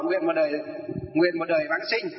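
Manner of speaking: ordinary talk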